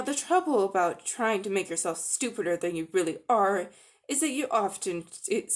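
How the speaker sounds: ordinary delivery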